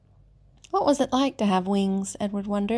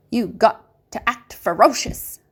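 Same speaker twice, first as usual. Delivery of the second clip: a gruff country woman urging her scarecrow; old woman coaxing, louder, in a put-on country voice; voiced old farm woman; 2 semitones higher, 5 dB louder than usual. A narrator's reading. Old woman to the scarecrow rabbit, a bossy country voice.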